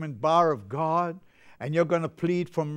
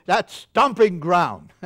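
put-on slow, louder quote of an old friend's line; a hint of the friend, drawn out; Hubert Smith's saying; 2 semitones higher, 6 dB louder, slower than usual. Preacher quoting friend Hubert Smith, slow and louder. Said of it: "Like my good friend Hubert Smith used to say".

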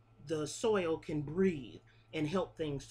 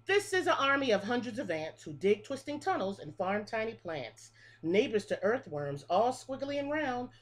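lilting picture-book read-aloud, each line rising then falling; reading to children; 3 semitones higher, 4 dB louder than usual. Acted. Teacher reading a bug rhyme book to her class, sing-song. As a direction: read the rhyme like story time for little kids.

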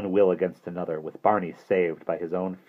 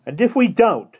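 high, loud challenge; rebellious; 8 dB louder than usual. A narrator's reading. Simon challenges Withers across the rocks, rebellious. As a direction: fling the question back rebelliously. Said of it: "Simon called rebelliously".

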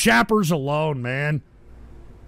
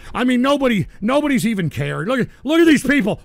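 usual speaking voice

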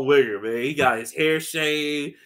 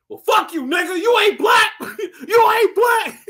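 high, loud mock yell; hammed-up angry shout; 7 dB louder than usual. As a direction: scream it at him, hammed up. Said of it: "He's like".

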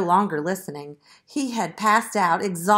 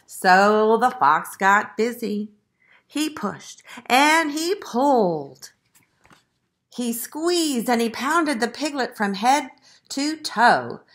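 slow, emphatic, louder narration; story narration; 3 semitones higher, 5 dB louder than usual. A narrator's reading. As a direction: act out each effort for children. Reads the story aloud.

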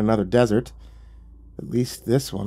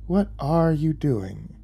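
higher, slow, drawn-out question; hint of amusement; 5 semitones higher, much slower than usual. Devil watching narrator dig, lightly amused. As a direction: ask with lazy devilish amusement. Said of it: "a hint of amusement in his voice".

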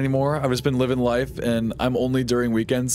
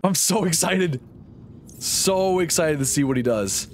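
sarcastic; 5 semitones higher than usual. Pretend.

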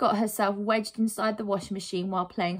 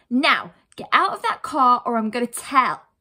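loud, raised-pitch threat; cross cry; 4 semitones higher, 8 dB louder than usual. Acted. Heroine threatens to tell on the boys, cross cry. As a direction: order them out with a warning. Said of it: "Lucy cried".

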